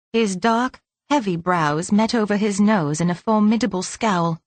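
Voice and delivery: ordinary delivery, nothing marked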